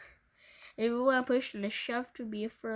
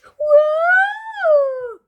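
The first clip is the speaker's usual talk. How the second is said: long high loud wail; Weepy William wailing loudly; 17 dB louder than usual. A narrator's reading. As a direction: wail like a crybaby. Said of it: "wailed William".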